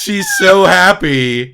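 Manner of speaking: loud, slow, laughing through the words; laughing disbelief. Real, not acted. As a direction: drag out so in amused outrage. Mocking the wife's reaction.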